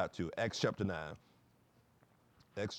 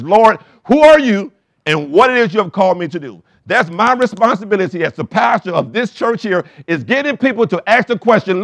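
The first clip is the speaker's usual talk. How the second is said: loud, high, driving preacher's voice; preaching; 8 semitones higher, 12 dB louder than usual. Acted. Pastor urging the congregation toward their road to Damascus, preaching loudly. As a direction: preach it out to the whole room.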